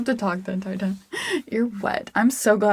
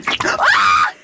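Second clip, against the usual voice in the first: high, loud startled shriek; sudden shriek; 5 dB louder than usual. Real, not acted. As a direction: burst out in a short scream. Shriek in make-me-laugh game.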